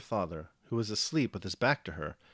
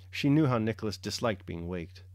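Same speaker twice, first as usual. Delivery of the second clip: usual speaking voice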